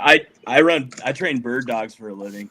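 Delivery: normal talking voice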